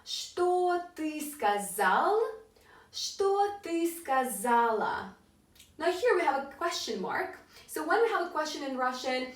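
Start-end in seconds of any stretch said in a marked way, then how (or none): 0.0-5.2 very slow, word-by-word modelling; slow model phrase. Shown as a demonstration. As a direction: stretch each word for learners. Teacher slowly models both forms.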